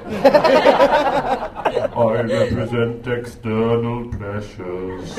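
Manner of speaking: drawn-out, mock-solemn announcement; milk each word of the label; slow mock dancer announcement. Comic announcing the dancer as external pressures, slowly.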